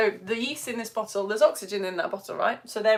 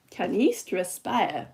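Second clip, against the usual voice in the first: slow, lower, odd-sounding take; odd put-on voice; 3 semitones lower, much slower than usual. Shown as a demonstration. Teacher repeats the question in a silly slow voice. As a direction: say it in a strange drawn-out voice. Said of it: "Don't know why I'm saying it like that".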